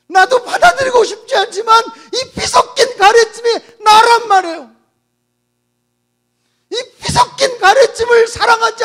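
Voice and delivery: dying woman screaming at the pastor; sobbing shout. Acted. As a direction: scream it through tears. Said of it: "소리소리 지르더래요".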